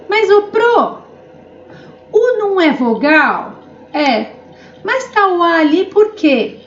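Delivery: lightly voiced puzzled student asking; a touch of a confused student. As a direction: question the teacher like a doubtful pupil. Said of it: "playing a questioning student". (leve voz de aluno confuso perguntando)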